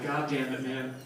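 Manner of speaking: low, soft, stoned dad mumble; stoned dad musing. Retold or imitated. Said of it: "was just like".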